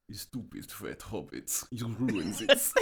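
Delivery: Gollum with a put-on Russian accent. Giving co-host an example of Russian Gollum, thick Russian accent. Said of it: "channel my inner Russian real fast".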